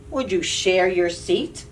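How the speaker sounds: ordinary delivery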